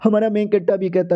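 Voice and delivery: usual speaking voice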